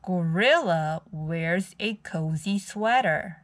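ordinary delivery

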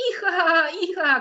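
high, loud whinnying shout; joyful horse yell; horse cry. Acted. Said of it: "krzyknął konik".

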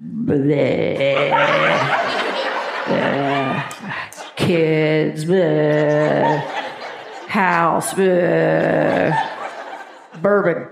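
low, far-off grunting "bleh"s; low mock grunts from a worn-out husband. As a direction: grunt it like a tired husband home from work. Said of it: "he said something to the effect of".

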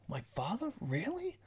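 a sudden jump up in pitch, stunned; surprised Goku. Retold or imitated. Goku hears about his father, high and astonished. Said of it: "Goku's like".

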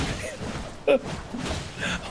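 wheezing high giggle; helpless squealing laughter; high breathless laugh. Real, not acted. Laughing at teammate's fail. (quietschendes Lachen)

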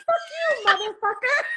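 high, lovely good-witch voice; sing-out the curse like a sweet fairy godmother; Glenda the Good Witch. Retold or imitated. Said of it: "like, Glenda the Good Witch from The Wizard of Oz".

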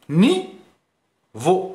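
slow, spelled-out نيفو; slow syllable demo. Shown as a demonstration. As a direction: stretch نيفو into two syllables. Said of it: "فيه دو سيلاب".

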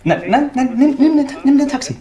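frantic, high-pitched begging; desperate, voice shooting up; panicked pleading. Acted. Panicked phone plea to Martha.

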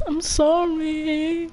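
high breathless laugh, then a slow apology; real laugh fading into a slow sorry; real laughter. Real, not acted. Laughing fit after a joke.

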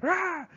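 a sharp high mock "Rah!" growl; teacher voicing Buck attacking Sol-leks, high mock roar. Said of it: "Buck's just jumping on him like".

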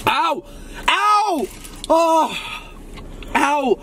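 sharp high yelps of pain. Real, not acted. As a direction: cry out from the burn. Burning mouth from spicy burrito.

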